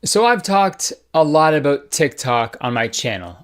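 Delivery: ordinary delivery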